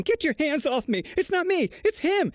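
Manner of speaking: very high-pitched Karen protest; mock screaming. Woman grabbed by security blaming someone else, mock screaming. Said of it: "She starts screaming".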